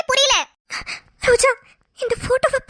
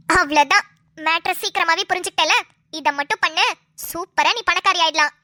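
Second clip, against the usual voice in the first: lower, urgent friend pressing her advice; a lower-voiced scheming friend urging the crying wife; scheming friend Roja; 6 semitones lower than usual. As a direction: push her to go home now, low and insistent.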